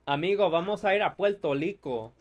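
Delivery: quick imitation of Puerto Rican Spanish, R turned to L; Puerto Rican accent. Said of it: "they swap the R with an L".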